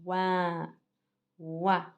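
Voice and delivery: "Ouin" as one nasal syllable, wan; teacher sounding out "Ouin" for pupils; pronunciation demo. Shown as a demonstration. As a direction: model O-I-N as "Ouin", then repeat it. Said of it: "Comment je prononce O I N?".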